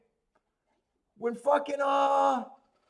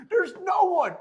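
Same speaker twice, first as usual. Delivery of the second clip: laughing; 11 semitones higher, 6 dB louder than usual. Real, not acted. Laughing at his friend's bet.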